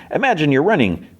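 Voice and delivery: high-pitched instructing voice; guiding bug. Retold or imitated. Bug talks Fiona towards Finn's mind room, high guiding voice. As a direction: play a little guide giving instructions.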